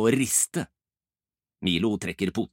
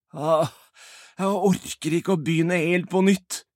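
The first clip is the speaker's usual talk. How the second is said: weary complaint; 7 semitones higher, 4 dB louder than usual. Acted. Albert gives up, slumps down.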